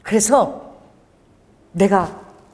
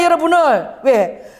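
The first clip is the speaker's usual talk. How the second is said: usual speaking voice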